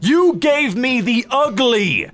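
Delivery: high, slow, whiny accusation; mock wailing complaint. Accusing his ancestors of his looks, whining. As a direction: whine it at your ancestors.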